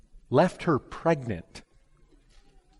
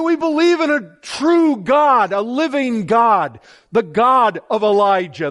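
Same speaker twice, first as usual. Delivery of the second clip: much louder and higher, ringing proclamation; a preacher rousing the congregation about the true God; climactic preaching; 6 semitones higher, 8 dB louder than usual. Acted. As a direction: proclaim the living God at full force.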